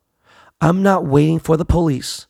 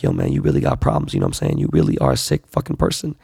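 imitating his wife in a much lower voice; a gruff, disapproving wife; mock wife; 11 semitones lower, faster than usual. Wife scolding him for wanting to shoot, low mock voice. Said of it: "my wife is like".

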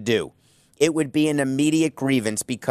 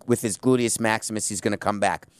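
normal talking voice

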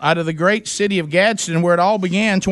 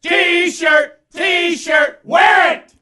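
excited hype shout; 10 semitones higher, much slower than usual. Acted. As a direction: shout it like a stadium hype man. Host hypes T-shirt prize.

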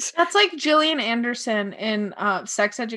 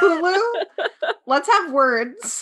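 mock confrontation; 6 semitones higher, 4 dB louder, slower than usual. Mock challenge to a streaming company.

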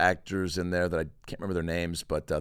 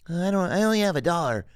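put-on higher, small timid kid voice; meek little boy voice; 8 semitones higher, 5 dB louder, faster than usual. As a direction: plead like a shy poor boy. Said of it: "he's like".

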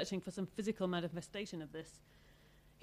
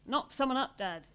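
loud and higher; said loudly; 6 semitones higher, 7 dB louder than usual. A narrator's reading. Teenage sister announcing it to her father, loud. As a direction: blurt it out for all to hear. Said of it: "she said loudly".

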